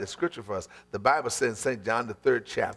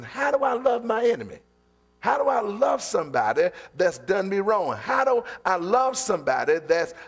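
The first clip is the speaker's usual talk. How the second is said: full-force preaching; 6 semitones higher than usual. Acted. Preacher rousing the church about loving enemies, full-force preaching. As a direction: preach it to the rafters, voice climbing.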